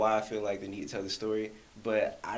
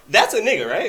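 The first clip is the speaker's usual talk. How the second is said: louder and higher, half laughing; excited outburst; 10 semitones higher, 12 dB louder than usual. Real, not acted. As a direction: blurt it out in shock. Laughing at the reveal.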